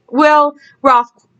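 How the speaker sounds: usual speaking voice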